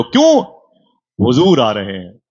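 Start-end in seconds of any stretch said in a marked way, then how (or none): 0.0-0.4 sharp challenge. Real, not acted. Preacher's rhetorical challenge.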